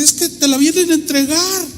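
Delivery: high-pitched imitation of an excited mother. Mother telling daughter the wallet is being returned, excited. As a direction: pitch it up, urgent and retold.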